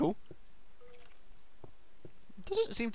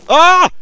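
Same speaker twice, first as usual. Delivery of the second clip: sudden high loud scream of fright; short real fright scream; startled scream; 11 semitones higher, 18 dB louder than usual. Real, not acted. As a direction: shriek at the jump scare. Skeleton dungeon jump scare.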